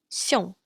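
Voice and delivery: xiong with a rounded yong ending; Mandarin xiong. Shown as a demonstration. Teacher gives xiong as an iong example. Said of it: "For example".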